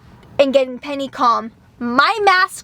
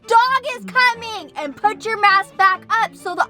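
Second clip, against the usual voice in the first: retold yelling; 11 semitones higher than usual. Wife scolding husband in the store, angry yell. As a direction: scold him sharply in public. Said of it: "I was yelling".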